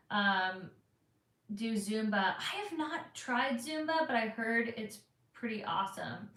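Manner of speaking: usual speaking voice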